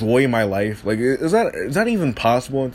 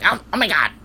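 high, shocked schoolteacher voice, well above his own; mocking the fussy teacher in a squeaky high voice; mocking prissy teacher; 8 semitones higher than usual. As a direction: mimic an uptight teacher clutching pearls. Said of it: "he's like".

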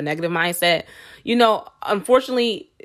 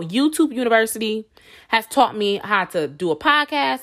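ordinary delivery

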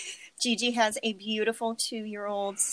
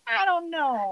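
high, wailing mock panic; hammed-up helpless panic; mock panicky; 10 semitones higher, 5 dB louder than usual. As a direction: play up being flustered, pitch jumping up. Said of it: "I get all panicky".

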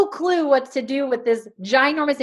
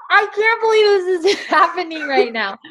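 high, loud mock disbelief with a laugh; can't believe it, laughing; 6 semitones higher, 5 dB louder than usual. Rep manager to customer, louder and higher in laughing disbelief. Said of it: "I'm like".

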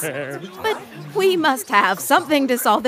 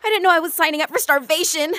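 fast, breathless rising panic; panicking; 3 semitones higher, much faster than usual. Acted. Penelope panics aloud to the crew. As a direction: rush it as panic builds. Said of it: "I'm gonna hyperventilate".

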